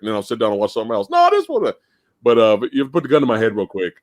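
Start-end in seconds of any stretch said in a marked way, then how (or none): 1.1-1.7 much higher mock insistence; emfatyczny protest. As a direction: flip to a new favorite in a high-pitched protest. Same protest about another movie.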